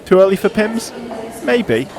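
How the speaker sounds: dramatic scripted intro read over music; documentary narration. Acted. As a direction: read it like a sports documentary voiceover. Scripted podcast cold open.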